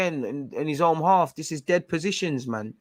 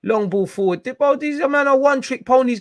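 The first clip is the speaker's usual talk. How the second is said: angry rant; 8 semitones higher, 7 dB louder than usual. Real, not acted. Ranting at the opponents on screen.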